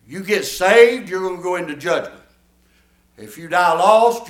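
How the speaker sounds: ordinary talk, nothing marked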